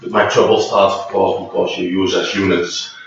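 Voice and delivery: ordinary talk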